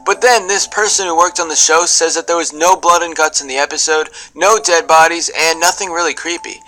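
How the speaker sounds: normal talking voice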